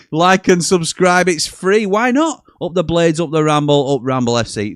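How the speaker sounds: loud, high rallying call to the fans; excited cheering. Real, not acted. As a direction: rouse the crowd with a chant. Cheering sign-off to viewers.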